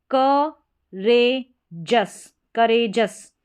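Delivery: "करेजस" drawn out slowly, ending in a short -जस; slow model word. Shown as a demonstration. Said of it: "करेज पढ़ेंगे पहले".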